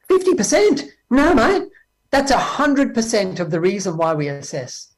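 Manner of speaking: mock Aussie indignation; playful Australian mate voice; Australian accent. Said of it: "they talk like that".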